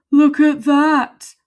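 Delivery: loud, slow marvelling skeleton voice; big skeleton. Acted. Big skeleton gawps at the collapsed dog, loud and slow. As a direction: draw it out in wonder.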